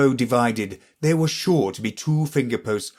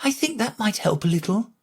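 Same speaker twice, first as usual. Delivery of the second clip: timid; 10 semitones higher than usual. A narrator's reading. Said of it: "she said timidly".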